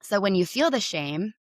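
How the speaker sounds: ordinary delivery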